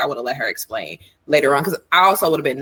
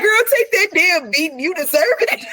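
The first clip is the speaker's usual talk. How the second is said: high mock scolding; 13 semitones higher, 3 dB louder than usual. Mock telling friend off, laughing.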